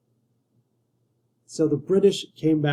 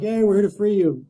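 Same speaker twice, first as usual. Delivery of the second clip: higher, louder hammed-up cheer; mock eager American; 6 semitones higher, 5 dB louder than usual. Teacher mocking Americans arriving to free Canada, hammed-up cheer. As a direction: chirp it like a naive liberator, pitched up and loud. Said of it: "Americans are like".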